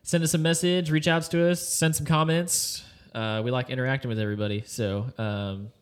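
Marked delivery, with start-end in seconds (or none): 0.0-2.8 bright, slow emphatic sign-off; upbeat outro. Real, not acted. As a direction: pitch it eagerly to listeners. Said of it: "outro invitation".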